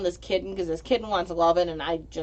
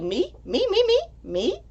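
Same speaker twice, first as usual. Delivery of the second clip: squawky climbing seagull voice, rising much higher; seagull impression; 13 semitones higher than usual. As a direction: mimic greedy cartoon seagulls. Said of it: "where they're like".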